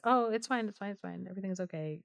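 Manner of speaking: calm her down gently; soothing. Retold or imitated. King comforts his crying queen, softly soothing. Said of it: "And so the king was like".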